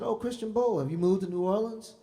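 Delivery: high, loud, overjoyed hello; hammed-up shouting into the phone; mock loud greeting. Teller mimics answering the young singer's call, hammed-up loud. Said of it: "I said".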